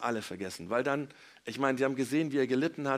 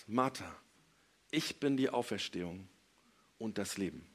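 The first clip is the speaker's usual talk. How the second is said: quieter, measured words with solemn pauses; ruhiger Zuspruch; 5 semitones lower, 5 dB quieter than usual. Retold or imitated. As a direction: let each phrase sink in, falling low at the end. Jesus spricht feierlich zu Martha. (ruhig und feierlich, mit Pausen)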